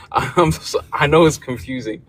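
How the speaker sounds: words broken up by a sheepish laugh. Real, not acted. Guest laughing at her own confusing background, sheepish laughter. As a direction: laugh through it, a bit embarrassed.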